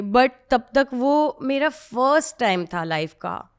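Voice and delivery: ordinary talk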